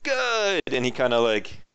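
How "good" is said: a sudden much higher, louder Good!; delighted. Acted. Hernando thrilled the runner still has the crew's numbers, delighted. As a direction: burst out with delight.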